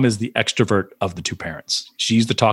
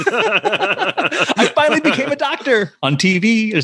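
laughing; slower than usual. Real, not acted. Laughing at his own triumph.

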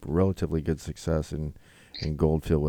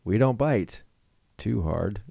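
teasing commercial voice, a little higher; ad read; 5 semitones higher, slower than usual. Acted. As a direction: sell the line like a cheesy ad. Ad read punchline.

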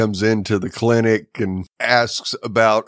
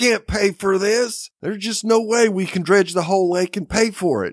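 exasperated mayor; 9 semitones higher, faster than usual. Retold or imitated. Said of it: "He's like".

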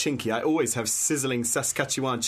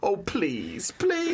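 higher, wheedling begging; playful pleading; 7 semitones higher, much slower than usual. Acted. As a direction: coax with a drawn-out please. Colleagues beg her to say it.

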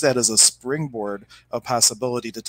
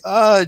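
a sharp high yelp, much louder; mock startled yelp; 9 semitones higher, 8 dB louder than usual. Reader hit by the upset woman, startled yelp. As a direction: yelp as if just smacked.

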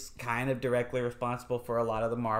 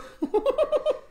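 high giggle; 4 dB louder than usual. Retold or imitated. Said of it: "Alan Rickman pretending to be an American".

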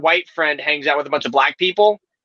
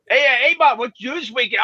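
put-on higher, broad Maine accent; 6 semitones higher than usual. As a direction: do a local Maine guy's accent. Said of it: "in this tone and accent".

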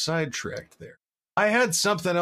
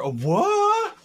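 squeaky high disbelieving what; sudden high-pitched what?; surprised what; 4 dB louder than usual. Real, not acted. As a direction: yelp it in shocked disbelief. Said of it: "three is too short for a phone number".

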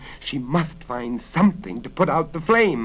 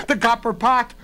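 high, loud excited exclamation; sudden realisation; 11 semitones higher, 5 dB louder than usual. A narrator's reading. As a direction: seize on a desperate idea. Heroine's sudden idea, voiced in narration.

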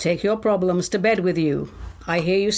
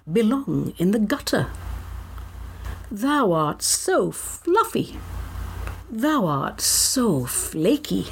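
slow, reverent mock ode; poem recital; slower than usual. Host praising Eccles cakes in an ode, slow mock-reverent recital. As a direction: draw out the words with devotional pauses.

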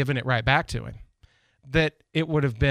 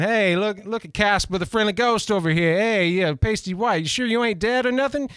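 bad New York accent; 7 semitones higher than usual. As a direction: rib him like a brash New York stagehand. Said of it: "forgive the bad accent".

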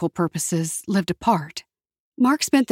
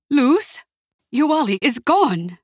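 trembling voice; 6 semitones higher, slower than usual. A narrator's reading. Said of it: "Her aunt's voice trembled".

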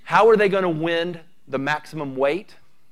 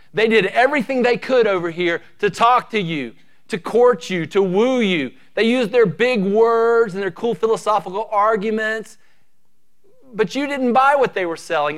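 louder, higher, preaching hard; rising fervour; 5 semitones higher, 5 dB louder than usual. Acted. Preacher rousing his congregation against false teachers, fervent rising preaching. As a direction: build the fire as you list their tricks.

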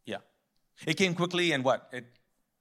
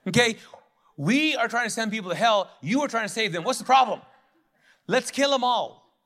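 louder, higher, ranting complaint; mock indignant Jonah; 5 semitones higher, 6 dB louder than usual. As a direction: rant at God like a petulant prophet. Preacher voices Jonah's ranting complaint.

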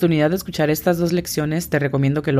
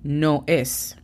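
"El animal es" drawn out slowly; slow example; much slower than usual. Shown as a demonstration. Teacher illustrating a sentence for students, very slowly.

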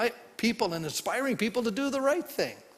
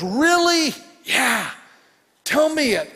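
much higher and louder, eager; a king suddenly thrilled to hear; excited king; 7 semitones higher, 8 dB louder, slower than usual. Retold or imitated. The king hears the woman is there, excited. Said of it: "And the king got excited".

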